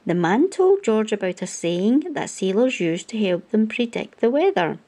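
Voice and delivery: ordinary delivery, nothing marked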